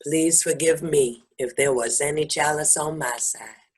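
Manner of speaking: low, soft and sincere; tender forgiveness. Real, not acted. Forgiving a friend and asking her forgiveness, tender.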